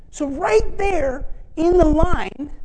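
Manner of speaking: slow, high and loud, each word drawn out; slow dramatic build. Real, not acted. Preacher building to the climax, slow and high. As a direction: hold the crowd on every word.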